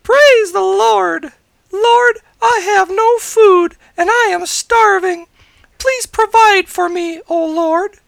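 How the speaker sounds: put-on very high old lady shouting her plea, slowly; shouted prayer. A narrator's reading. Starving old lady praying from her front porch, high shout. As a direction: holler a drawn-out appeal to heaven. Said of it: "stepped onto her front porch and shouted".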